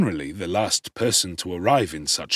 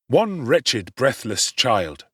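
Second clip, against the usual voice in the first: usual speaking voice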